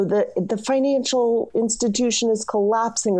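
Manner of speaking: high-pitched imitation of an alarmist shout; mock shouting. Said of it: "kind of random people just shouting".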